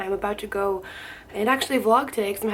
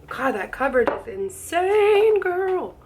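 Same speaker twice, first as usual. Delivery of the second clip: slow, higher amazed gush; drawn-out, impressed exclamation; 5 semitones higher, slower than usual. Real, not acted. Amazed at the coverage.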